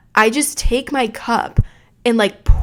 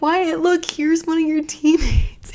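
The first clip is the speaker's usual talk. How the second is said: breathy whispered tease, voice pitched up; teasing whisper; 8 semitones higher, slower than usual. Retold or imitated. To her son Wyatt, pointing out the baseball kid, in a teasing whisper. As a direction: lean in and whisper it like a secret joke.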